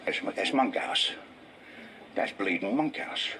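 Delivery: a hint of a cheeky Londoner; passers-by recognising him. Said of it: "people see me come and they go".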